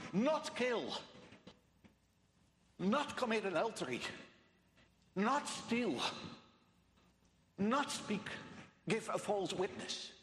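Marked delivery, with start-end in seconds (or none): 0.1-8.3 each "Not" hit high at the start; stress on NOT. Shown as a demonstration. Preacher listing commandments in Hebrew order, "Not" stressed first. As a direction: punch "Not", then fall through the verb. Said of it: "All emphasis is on".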